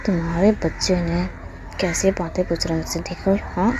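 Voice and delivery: hushed, half-whispered grumbling threat; muttered anger. A narrator's reading. Embarrassed mum muttering threats at absent Wang ji, quietly angry. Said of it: "गुस्सा कर रहा था".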